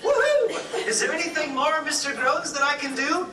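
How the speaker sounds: high, laughing woohoo then giddy talk; gleeful whoop. Acted. Clerk whooping gleefully at the frustrated guest. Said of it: "She went".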